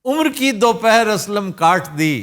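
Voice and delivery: धीमे अंदाज़ में शायरी; मुशायरे की तरह ठहर कर शेर सुनाओ; शेर पढ़ना. Retold or imitated. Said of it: "Aslam कौलसरी का शेर". (शायराना अंदाज़)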